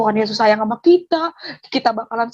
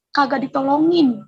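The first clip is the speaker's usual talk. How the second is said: ordinary delivery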